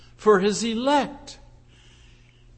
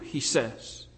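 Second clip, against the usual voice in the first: ordinary delivery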